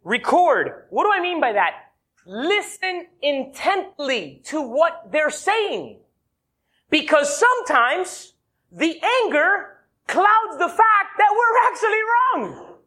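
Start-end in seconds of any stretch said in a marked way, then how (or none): 2.2-12.8 slow, higher urgent preaching. Acted. Preacher urging listeners to listen, slow and high. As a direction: plead with them slowly. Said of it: "preaching emphasis".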